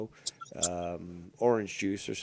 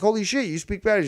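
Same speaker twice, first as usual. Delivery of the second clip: high, fast, loud disbelief; amazed; 9 semitones higher, 5 dB louder, faster than usual. Retold or imitated. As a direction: blurt it out, amazed and quick. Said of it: "I'm like".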